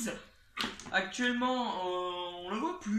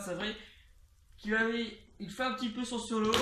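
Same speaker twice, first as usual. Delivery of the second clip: ordinary delivery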